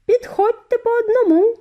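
high, loud call to the crowd. A narrator's reading. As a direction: summon the children forward. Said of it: "покликав він". (високий гучний поклик)

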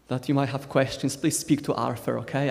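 usual speaking voice

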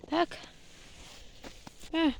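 usual speaking voice

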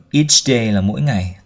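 normal talking voice

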